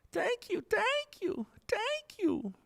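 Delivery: very high, sing-song thank yous; silly high thanks. Pretend. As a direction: squeak your thanks playfully.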